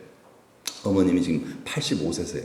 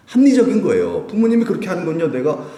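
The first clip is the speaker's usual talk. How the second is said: raised, high-pitched exasperated complaint; high, loud burst of frustration; upset protest; 7 semitones higher, 6 dB louder than usual. Acted. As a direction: argue your case, upset and worked up. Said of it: "속상해요".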